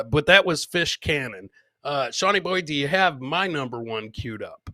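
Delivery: ordinary delivery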